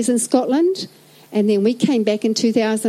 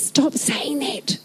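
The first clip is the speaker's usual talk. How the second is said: husband's quiet protest; 4 dB quieter, much slower than usual. Retold or imitated. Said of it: "hubby goes".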